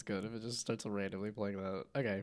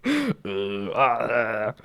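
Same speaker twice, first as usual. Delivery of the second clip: zombie moan; 12 dB louder than usual. Pretend. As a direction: groan like a shambling zombie. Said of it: "there's some audio".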